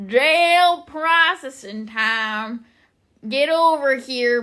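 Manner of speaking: booming, high Southern holler, vowels stretched long; hammed-up imitation of a Southern jail guide; Southern accent. Imitating a girl at the old jail calling visitors over, loud Southern drawl. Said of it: "I loved doing a fucking Southern accent".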